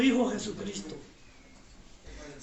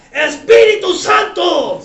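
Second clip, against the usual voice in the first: much louder, calling out each line; shouted prayer lead; 4 semitones higher, 13 dB louder, slower than usual. Real, not acted. Pastor leads the standing congregation line by line, shouting. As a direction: shout the prayer for the church to echo.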